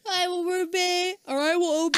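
high, scratchy chant of obedience; scratchy brainwashed voice. Retold or imitated. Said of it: "She has a very scratchy voice".

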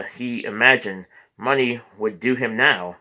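ordinary delivery

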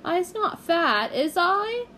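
put-on high, slow wheedling child; small girl appealing to Solly; pleading little girl. A narrator's reading. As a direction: appeal sweetly.

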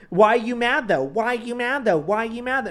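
needling, high-pitched chant; mocking sibling taunt. Siblings pestering a tired kid, mocking.

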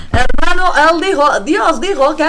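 mock the pastor father's borrowed Central American sermon accent; imitating pastor father's Central American accent. Daughter mocking her pastor father's sermon, loud Central American accent. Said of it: "tienen que imitar este acento".

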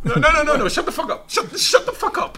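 high laughing no, no, no; laughing protest. Real, not acted. Laughing at his friend, telling him to shut up.

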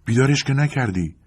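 high-pitched questioning surprise; taken-aback question; surprised. A narrator's reading. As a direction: ask it startled, pitch jumping up. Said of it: "با تعجب پرسید".

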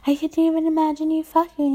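usual speaking voice

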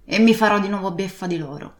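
loud, fast, indignant sailor's rant; ranting old pirate. Acted. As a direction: rage at the thieves hunting you. Captain rants about his enemies.

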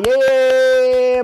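loud happy yeay; cheer. Real, not acted. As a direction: cheer for the right answer. Cheering a viewer's answer. (sorakan gembira)

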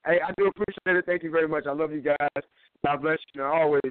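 hollow, echoing phone audio; like talking in a big hall. Real, not acted. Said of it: "sorry for the, uh, echo".

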